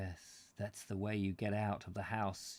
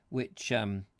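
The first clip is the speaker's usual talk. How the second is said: plain reading voice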